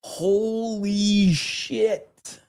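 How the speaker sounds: stunned, slow, drawn out; shocked. Real, not acted. Streamer shocked by a viewer's fan art, slow and stunned. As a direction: let the horror sink in slowly.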